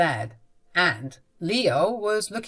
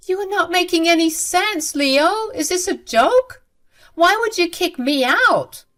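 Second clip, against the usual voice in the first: very high, raised voice of disbelief; acted distraught mother; incredulous protest; 10 semitones higher, 5 dB louder than usual. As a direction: plead for it to be a joke, shocked. Mother disbelieving she is evicted.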